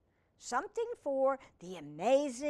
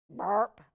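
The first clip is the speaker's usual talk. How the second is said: mock burp; 6 dB louder than usual. Fly burps in story.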